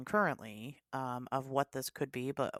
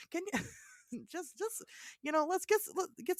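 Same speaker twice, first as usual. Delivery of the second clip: giggling; 14 semitones higher than usual. Real, not acted. Host breaking into giggles.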